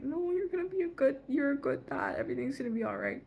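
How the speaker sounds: high tearful plea, easing down; mock-bawling, starting high; imitated bawling. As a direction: re-enact weepy reassurance, settling toward normal. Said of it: "I was bawling in bed".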